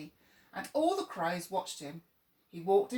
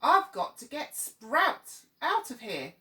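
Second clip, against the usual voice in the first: higher, louder, slow crow screech; a frantic crow screeching to escape; slow storybook screech, pitched up; 6 semitones higher, 6 dB louder than usual. Acted. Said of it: "screeched another".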